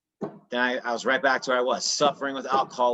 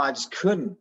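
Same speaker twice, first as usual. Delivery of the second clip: ordinary talk, nothing marked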